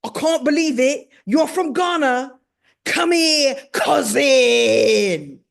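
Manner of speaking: British disbelief building to a big shout; elated British accent. Retold or imitated. Idris learning she's from Ghana, jubilant British accent. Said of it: "he looks at me and he goes".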